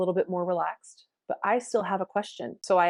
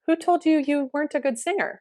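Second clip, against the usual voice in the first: higher, curious challenge; probing question; 8 semitones higher, 4 dB louder than usual. Retold or imitated. Teacher challenging student's belief about singing, probing question. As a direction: ask it high and pointedly.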